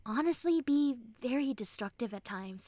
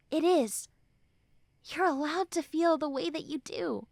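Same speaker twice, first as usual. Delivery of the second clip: ordinary delivery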